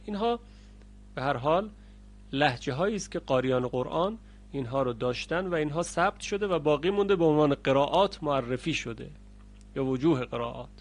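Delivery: usual speaking voice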